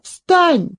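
put-on high, urgent girl's pleading; crying out. A narrator's reading. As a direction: urge fallen grandfather up in alarm. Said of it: "закричала она". (высокий встревоженный крик)

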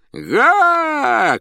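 long, piercingly high whoop; shouting over roar. A narrator's reading. Clown tries to outshout the roaring audience, shouting. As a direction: yell to drown out a roaring crowd. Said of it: "крикнул он, стараясь перекричать рёв".

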